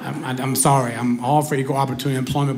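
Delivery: usual speaking voice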